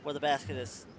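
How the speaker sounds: normal talking voice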